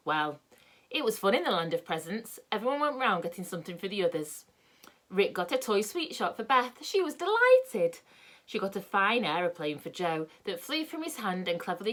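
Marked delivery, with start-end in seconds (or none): none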